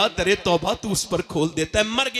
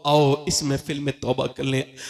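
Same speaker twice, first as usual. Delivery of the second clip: ordinary delivery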